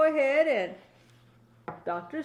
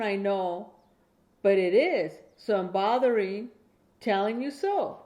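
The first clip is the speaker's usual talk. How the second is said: steady, clear bedtime story reading, a bit slower; reading to a child; 5 dB louder than usual. Acted. Grandma reading a Dr Seuss bedtime book to her grandchild, clear storytelling. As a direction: read it out like a bedtime book for a little one.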